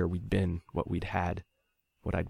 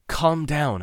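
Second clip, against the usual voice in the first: gasped; 9 semitones higher, 5 dB louder than usual. A narrator's reading. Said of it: "I gasped".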